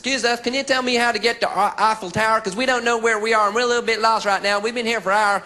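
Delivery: mock American tourist asking directions. Lost American tourist asking a Parisian the way, in an American accent. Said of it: "approached by an American".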